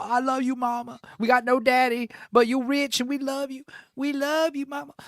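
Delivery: high, sugary doting-son voice; mock singsong sons. Sons fawning over their rich mama, mock singsong. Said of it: "they sing at her".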